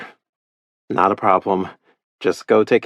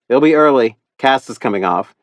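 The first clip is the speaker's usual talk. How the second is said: calling back; 5 semitones higher, 6 dB louder than usual. Acted. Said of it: "I call back".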